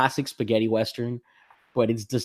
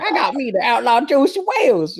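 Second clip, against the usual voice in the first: hillbilly voice; 5 dB louder than usual. Retold or imitated. Host mocking a hillbilly sidekick for his co-host, hammed-up twang. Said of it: "the hillbilly one".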